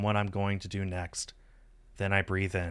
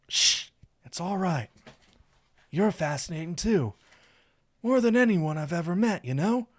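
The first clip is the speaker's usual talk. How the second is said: higher-pitched, tender character voice; affectionate, reassuring Saphir; 9 semitones higher, 4 dB louder than usual. A narrator's reading. Saphir telling his lover he is fascinating, reassuring. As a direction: soothe your lover with admiration.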